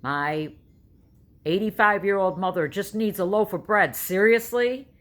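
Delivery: exasperated man yelling in a checkout line; yelled out. Retold or imitated. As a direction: call out to the whole store in exasperation. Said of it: "yelled out".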